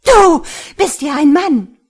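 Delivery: high, very loud outraged cry; wild scream. A narrator's reading. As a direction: scream it in shocked fury. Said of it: "Ein wilder Schrei ließ ihn zusammenfahren".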